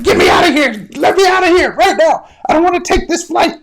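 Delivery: loud, high-pitched frantic outburst; panicked passenger. Acted. Passengers hearing an honest pilot's confession, panicked high shout. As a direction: yell it like you need off right now.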